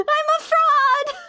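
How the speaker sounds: high, slow hammed-up shout of fake swear words; mock swearing. Shown as a demonstration. Streamer demos her fake swearing to chat, hammed-up. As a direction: yell it like you're cursing, but it's harmless. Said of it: "opening my mouth and going".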